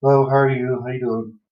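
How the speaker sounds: a lightly voiced upbeat greeting, the first words lifted; cheery service greeting. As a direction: greet the patient like a chipper server. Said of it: "go over the whole spiel".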